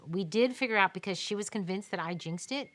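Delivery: ordinary delivery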